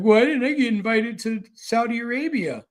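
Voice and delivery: mild mock whine of a left-out leader; whiny mock Zelensky. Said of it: "what Zelensky was crying about".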